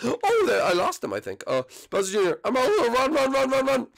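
high, frantic escape voice; high, urgent and panicking during the jailbreak; panicked prisoner. Acted. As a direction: scramble to get everyone out.

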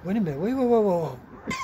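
stretched, pleading cries of wait; urgent calling out. Retold or imitated. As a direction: call out to stop him leaving. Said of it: "x-ray tech said".

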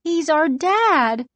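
high, slow small voice; little child's high, slow voice; tiny little voice. A narrator's reading. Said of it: "in a tiny little voice".

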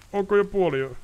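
high, uncertain call into the dark; nervous call. Acted. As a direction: call out to check he's there. Ostrid fearful.

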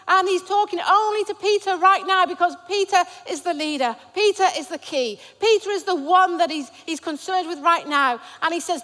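ordinary talk

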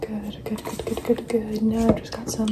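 whispered asmr. Acted.

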